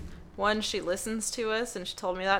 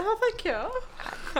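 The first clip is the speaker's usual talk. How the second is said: awkward slow thank you; 13 semitones higher, much slower than usual. Retold or imitated.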